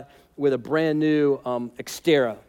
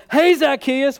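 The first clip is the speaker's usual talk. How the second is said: higher, louder call up into the tree; 7 semitones higher, 4 dB louder, slower than usual. Acted. Jesus calling up to Zacchaeus in the tree, loud call. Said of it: "he calls him by name".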